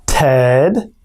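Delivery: Fred said slowly with the R stretched; slow broken-down word. Shown as a demonstration. Teacher breaking down the tongue twister slowly. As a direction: draw out Fred sound by sound. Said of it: "break the tongue twister down".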